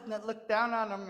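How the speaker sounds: ordinary delivery, nothing marked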